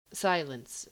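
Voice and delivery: usual speaking voice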